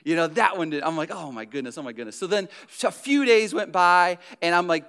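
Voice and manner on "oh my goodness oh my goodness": quiet dismay. Retold or imitated. Fish just died.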